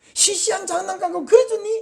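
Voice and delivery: high, sneering mock question; mock stingy parent. A stingy parent sneering at the child, mocking. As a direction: scoff at the cheap toy.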